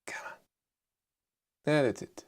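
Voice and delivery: ordinary talk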